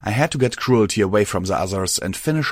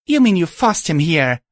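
spat; 9 semitones higher, 4 dB louder than usual. Acted. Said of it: "I spat".

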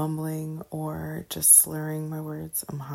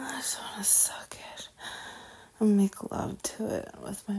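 breathy aroused whisper; 3 semitones higher, slower than usual. Acted.

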